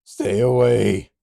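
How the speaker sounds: slow, loud desperate warning; desperate shout. Acted. Cornered boy warns Lycos, slow desperate shout. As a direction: yell it as a last warning.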